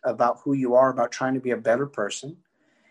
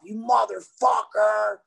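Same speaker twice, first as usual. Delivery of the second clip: slow, higher mock shout at the cop; hammed-up angry yell; retold insult; 8 semitones higher, slower than usual. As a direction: drag it out, insulting. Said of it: "I was calling the cop".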